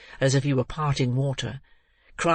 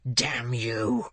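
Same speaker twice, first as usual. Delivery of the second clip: whisper; 3 semitones lower than usual. A narrator's reading. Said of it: "cried the desperate ruffian".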